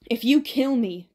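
loud, slow threat; half-shrieked warning, drawn out; mild shriek. A narrator's reading. Cornered Ben facing Albie's drawn bow, shrieking. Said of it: "Ben shrieked".

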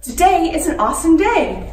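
cheering affirmation; loud and powerful. Shown as a demonstration. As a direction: shout it with a big jump. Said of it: "loud and powerful".

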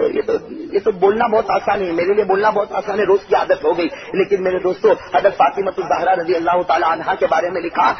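ordinary talk

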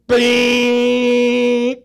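high, loud honk sound; a short loud "Bing!" horn; imitated car horn. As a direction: blast it like an impatient horn.

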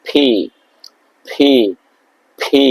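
ফি with a strong puff of air; P as ফি, breathy burst; aspirated P. Shown as a demonstration. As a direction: blow air through the lips on ফি. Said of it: "দুই ঠোঁট একসঙ্গে ইয়ে করে".

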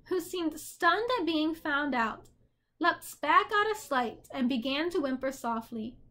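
usual speaking voice

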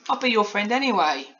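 put-on high-pitched little girl; Polly. A narrator's reading. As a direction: offer friendship in a child's voice. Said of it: "said Polly in astonishment".

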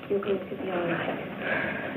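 very soft, slow comfort; hushed reassurance. Acted. Woman comforting her injured friend, hushed and slow. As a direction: soothe her under your breath.

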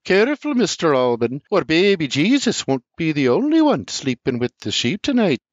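high, loud scolding warning; Sarah scolding R J; barking threat. A narrator's reading. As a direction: snap a warning at him.

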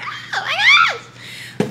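very high, slow laughing squeal; giddy laughing oh my gosh; overwhelmed squeal. Real, not acted. Overwhelmed by subscriber support.

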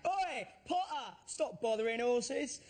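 slow, high mock heckle called out; swaggering Londoner shout. Londoner heckling Daniel Radcliffe at the stage door. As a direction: heckle across the street, drawn out. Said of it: "shouted out really loudly".

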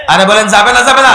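fast, high, loud question; insistent demand. Real, not acted. Preacher pressing the crowd to answer him, loud insistent demand. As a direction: fire it at the crowd to force an answer.

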